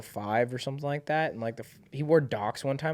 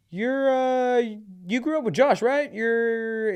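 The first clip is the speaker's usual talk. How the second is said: imitating Darius; 11 semitones higher, 4 dB louder, slower than usual. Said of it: "Darius is like".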